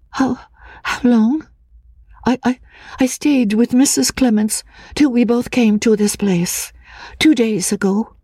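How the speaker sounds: put-on high, hesitant, frightened girl's voice; a nervous fragile woman, stammering; lightly breathy, fearful young woman; timid young woman. A narrator's reading. Anne's voice in dialogue.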